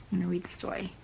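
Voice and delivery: soft whispered aside. Real, not acted. Vlogger whispering to camera while reading in bed. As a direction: whisper it as if someone's sleeping nearby. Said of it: "unvoiced whisper".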